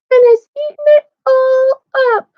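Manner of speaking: put-on very high, loud Baby Bear; a tiny bear piping up in protest; wee little voice. A narrator's reading. Baby Bear complains about his pudding, wee little voice. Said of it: "in his wee little voice".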